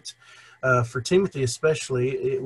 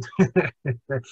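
brief chuckle; real chuckle. Real, not acted. Co-host remembers when they used to eat out, real chuckle. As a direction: laugh lightly to yourself.

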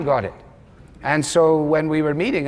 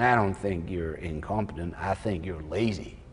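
low, soft, slow Texas drawl; deadpan Texan verdict, drawn out low; a low, quiet Texan drawl delivering a blunt verdict; 9 semitones lower, 9 dB quieter than usual. Retold or imitated. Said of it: "I, I shouldn't do his accent".

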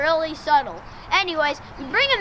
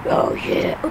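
low, voiceless growl of a superhero; throaty Batman parody. Acted. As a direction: slip into a rough Batman voice. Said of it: "That's more Batman".

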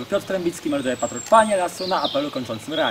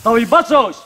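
barked parade command; drill sergeant; 3 semitones higher, 7 dB louder than usual. Real, not acted. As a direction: call the ranks to attention.

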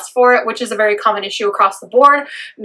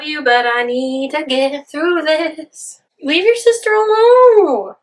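much higher, slow, drawn-out pet talk; 5 semitones higher, slower than usual. Real, not acted. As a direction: coo a telling-off at the cat. Telling the cat off.

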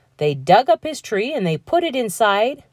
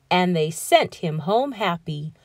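plain reading voice

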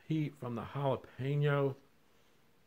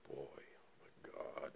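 whispering; 8 semitones lower, 16 dB quieter, slower than usual. Real, not acted. Tasting hot jalapeno, whispered.

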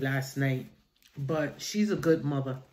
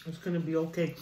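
gentle soft reassurance; calm him softly; soothing, softer; 6 dB quieter, faster than usual. Real, not acted. Comforting sick grandson.

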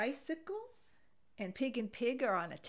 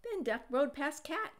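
usual speaking voice

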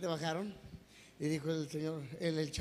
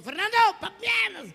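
high, loud comic shout; acted wifely screech; shrieking wife; 12 semitones higher, 9 dB louder than usual. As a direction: yell for the husband at the top of your register. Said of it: "entró la señora y le gritó".

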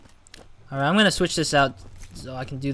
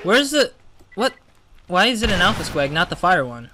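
surprised; 4 semitones higher, 6 dB louder than usual. Real, not acted. Wrong enemy appears.

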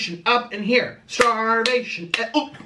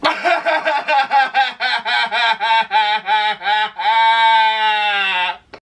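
shouting laugh burst; loud laugh; 5 dB louder than usual. Real, not acted.

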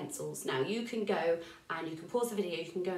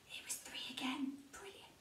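whispering; 4 semitones higher, 14 dB quieter than usual. Acted. Quiet aside to children.

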